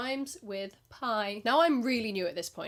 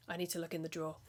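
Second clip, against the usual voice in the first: lower, hushed shifty request; shifty low customer; 5 semitones lower, 9 dB quieter, faster than usual. Retold or imitated. Imitating a furtive customer asking for the drawer, low and hushed. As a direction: mutter it like a shady deal. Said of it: "stare at me with their shifty little eyes".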